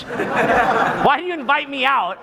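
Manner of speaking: slowly, giggling at the absurdity; laughing disbelief. Real, not acted. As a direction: laugh through the baffled question. Incredulous retold complaint.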